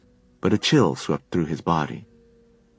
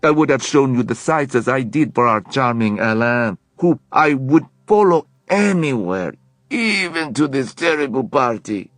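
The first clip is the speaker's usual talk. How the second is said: put-on French accent, higher and louder, charming; flirty French accent; 6 semitones higher, 5 dB louder than usual. A narrator's reading. Leon charming Alec about Paris, flirty French accent. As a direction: flatter him like a smitten Frenchman. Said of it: "a French accent".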